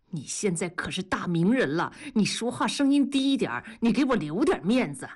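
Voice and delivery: ordinary delivery, nothing marked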